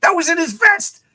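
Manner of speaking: very high, squealed mock horror; disgusted warning. Teen warns friend once Lemmy walks away, disgusted. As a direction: start hushed, then rise in appalled disbelief.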